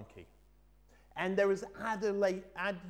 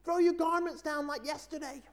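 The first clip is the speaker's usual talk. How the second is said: high, loud indignant donkey shout; 13 semitones higher, 5 dB louder than usual. Acted. Donkey shouts at the villagers by the well, high and loud. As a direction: shout up at the crowd, demanding to be noticed. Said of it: "he shouted out to them".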